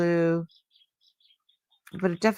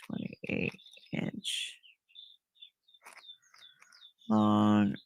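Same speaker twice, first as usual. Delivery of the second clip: whispered measuring; 7 dB quieter, much slower than usual. Real, not acted. Measuring to herself.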